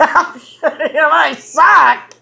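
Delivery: laughing echo. Real, not acted. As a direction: repeat the insult while cracking up. Echoes the insult, laughing.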